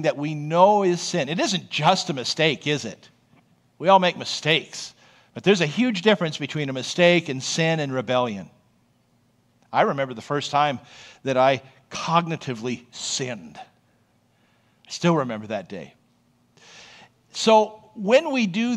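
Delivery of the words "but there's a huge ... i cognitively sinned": usual speaking voice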